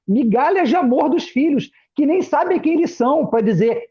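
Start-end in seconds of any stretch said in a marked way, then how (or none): none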